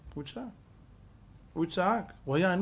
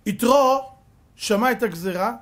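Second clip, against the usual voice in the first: usual speaking voice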